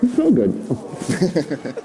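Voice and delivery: slow, laughing aside mid-hug; joking, drawn out with a chuckle; amused. Real, not acted. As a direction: break into laughter with the words. Joking while hugging the volunteer.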